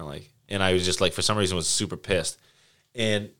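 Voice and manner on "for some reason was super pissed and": normal talking voice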